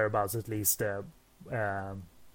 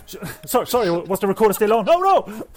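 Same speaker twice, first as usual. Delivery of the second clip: high, loud, fast fake panic; mock panic; 9 semitones higher, 11 dB louder than usual. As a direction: pretend you got caught on tape. Fake panic about recorder.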